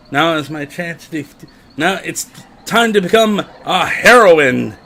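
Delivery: heroic declaration. Pretend. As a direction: proclaim it like a heroine. Reading the heroine's lines aloud.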